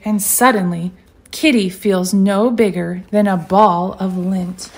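usual speaking voice

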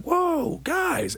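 shouted very high protest; startled yell. Retold or imitated. Pulled back by bouncers, startled yell. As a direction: yelp it at the bouncers.

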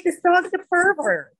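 high, loud mock-shocked exclamation; mock outrage. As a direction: pretend to be scandalized by the dog. Retelling her reaction to the dog.

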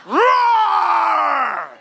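long high shouted roar; lion roar. Acted. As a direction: roar like a lion stalking its prey. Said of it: "I am now stalking prey".

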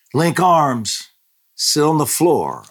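high, loud quick command; urgent order. Retold or imitated. Grandmother ordering family to link arms, urgent loud. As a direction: snap the family into place. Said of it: "I said".

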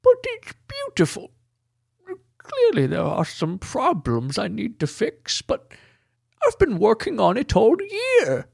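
very high excitable voice; high squeaky character voice. Acted. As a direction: shriek it in a tiny high voice.

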